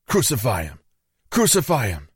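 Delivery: high, strained crowd cry; storyteller's mock shouting; narrated mob shout. A narrator's reading. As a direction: cry it out like a mob, held back in volume. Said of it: "Again they shouted".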